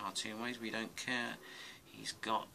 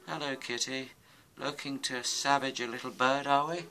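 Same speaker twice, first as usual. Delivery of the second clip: mock taunting; 5 semitones higher, 8 dB louder than usual. Teasing the kitten he drew.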